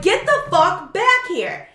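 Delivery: high, loud and slow; slow angry yell. Retold or imitated. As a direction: drag out the demand. Yelling after fleeing thief.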